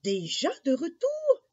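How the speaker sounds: high, loud surprised question; astonished mother. Acted. Mother sees Jacques back so soon, astonished. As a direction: exclaim in high-pitched surprise. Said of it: "s'étonna sa maman".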